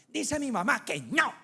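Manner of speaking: put-on child's voice reporting back; child messenger. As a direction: relay mom's refusal as the little go-between. Child relays mother's refusal.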